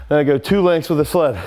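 ordinary delivery

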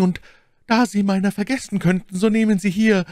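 very high feminine character voice; high, ardent lady declaring her love; Milady, tenderly amorous. A narrator's reading. Milady pledging love to the supposed count, high and ardent.

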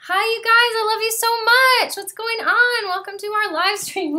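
high, loud, gushing hello; greeting livestream viewers, high and gushing. Real, not acted. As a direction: beam at the viewers, high and eager. Greeting viewers once live.

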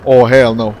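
loud, insistent refusal; mounting dismay. Real, not acted. Player repeating himself as the run goes wrong, mounting dismay.

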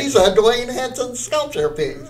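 high and loud, laughing through it; laughing excited quote. Retold or imitated. Mimicking visitors staring up a guard's nose, laughing and loud. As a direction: burst out laughing as you mimic the museum visitors. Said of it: "They go like".

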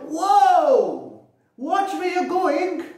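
high, loud warning shout; farmer's shout. Acted. Farmer shouting at the ducks, high and loud. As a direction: yell at the ducks on the road. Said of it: "the farmer Falgu shouted".

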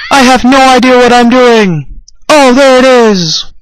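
loud excited exclaiming. Real, not acted. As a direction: shout it out, thrilled. Excited gameplay outburst.

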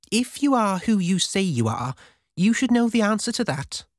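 high-pitched, pointed comeback; quipping. A narrator's reading. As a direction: toss it back as a challenge. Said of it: "she quipped".

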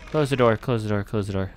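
usual speaking voice

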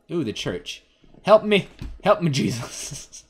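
ordinary talk, nothing marked

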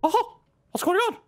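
very high squawky duck voice; Donald Duck. Acted. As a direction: quack it out like a cartoon duck. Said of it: "Donald Duck".

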